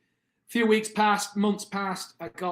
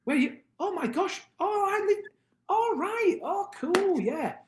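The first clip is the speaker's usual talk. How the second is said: much higher, gushing imitation of thrilled locals; mock excited locals. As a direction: ham up their delight at meeting a Brit. Locals greeting the British interviewer.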